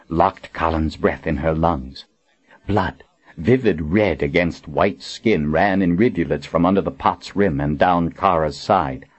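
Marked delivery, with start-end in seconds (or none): none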